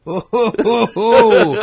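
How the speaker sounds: hammed-up "oh ho ho" mock laugh; fake laughing along. Guard humouring a sarcastic questioner, fake laughing along. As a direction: overplay being amused by a tired joke. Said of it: "doesn't mean you have to go".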